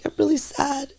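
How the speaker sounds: a light imitation of crying to someone; small high sad voice, retold; retold tearful confession. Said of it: "like, crying, and I was like".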